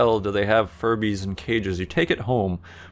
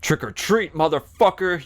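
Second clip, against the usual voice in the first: mock yell; 7 semitones higher than usual. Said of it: "you yell".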